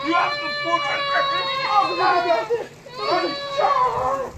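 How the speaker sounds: big high shout at the camera; exasperated high-pitched shouting. Real, not acted. Shouting at viewers about snow.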